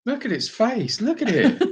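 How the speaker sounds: high, amused pet talk with a laugh; cooing at cat. Real, not acted.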